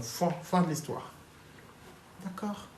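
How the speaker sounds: usual speaking voice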